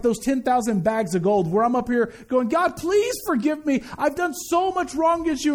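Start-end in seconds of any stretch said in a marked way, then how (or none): 2.5-5.5 high-pitched acted plea for mercy; pleading. Sinner prays to God for forgiveness, pleading.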